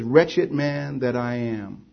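low, drawn-out, subdued lament; Paul's lament. Acted. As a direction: quote it slowly, as a soft confession of wretchedness. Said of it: "He says".

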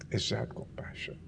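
breathy whispered question. Real, not acted. Pastor asking the congregation, whispered. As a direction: lean in and whisper it.

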